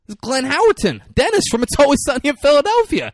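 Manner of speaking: loud, high, fast gush; telling a friend about the cast, excited; excited reveal. Real, not acted. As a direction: blurt it out thrilled.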